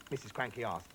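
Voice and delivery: plain reading voice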